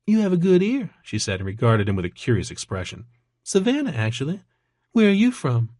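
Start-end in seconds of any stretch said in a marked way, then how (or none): 0.1-0.9 high-pitched Georgia waitress; playful question in a high Georgia accent. A narrator's reading. Waitress answering a curious customer, coy Georgia accent. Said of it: "an accent that was distinctly from Georgia". 3.4-5.7 high-pitched waitress from Georgia; friendly, lilting high Georgia accent. A narrator's reading. Waitress chatting about hometowns, Georgia accent. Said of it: "an accent that was distinctly from Georgia".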